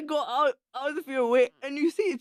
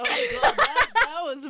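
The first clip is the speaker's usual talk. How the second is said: laughing; 10 semitones higher than usual. Real, not acted. After the witch cackle.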